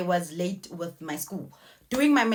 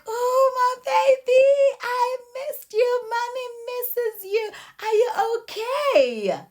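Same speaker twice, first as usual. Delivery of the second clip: much higher, overjoyed cooing; high joyful mother; 14 semitones higher, 3 dB louder than usual. Retold or imitated. Mother reunited with her baby son, high joyful cooing. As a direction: coo at the child like a long-missed baby.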